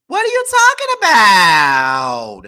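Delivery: mock Krishan. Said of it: "This is what Krishan's saying to [name]".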